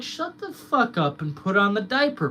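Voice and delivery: high, loud taunting voice; mocking rant. Streamer taunting overreacting critics, high and loud. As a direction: taunt them like a bully.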